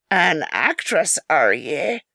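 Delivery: lightly voiced frail old man, slow; a hint of a creaky invalid. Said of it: "Two points of light quivered".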